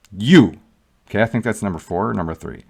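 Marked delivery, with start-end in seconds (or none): 0.1-0.6 a firm, louder you; stressed you. Shown as a demonstration. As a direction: punch you, pointing at one man. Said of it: "I'm gonna emphasize that".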